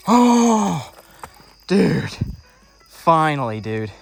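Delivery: loud joyful exclaim; excited. Real, not acted. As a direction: celebrate the catch out loud. First pickerel caught after months.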